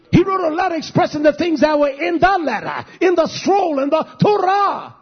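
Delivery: rising sermon fervor; fiery preaching. Acted. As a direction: preach it hard to the congregation. Preaching at full force.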